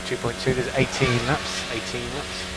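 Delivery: ordinary talk, nothing marked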